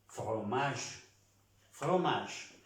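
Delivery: "fromage" in French, ending on a zh sound; silent final E. Shown as a demonstration. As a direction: model "fromage" with the last E dropped. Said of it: "No se pronuncia la E".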